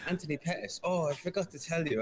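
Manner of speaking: high, offhand German accent, imitating the manager; casual German accent, high and breezy; mock German accent. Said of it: "and he was like".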